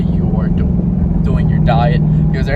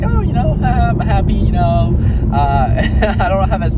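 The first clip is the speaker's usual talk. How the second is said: laughing; 7 semitones higher, 5 dB louder, slower than usual. Retold or imitated. Mocking his own contentment.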